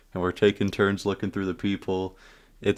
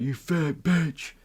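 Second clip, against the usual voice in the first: mock yell; 8 semitones higher, slower than usual. Friend taunts the moms from the balcony, mock yell. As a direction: imitate a slow taunting call. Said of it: "my friend yells out".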